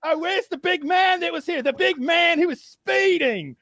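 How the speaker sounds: very high, slow, hammed-up pleading; mock crying like a little girl. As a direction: wail like a frightened little girl. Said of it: "The man's crying like a little girl".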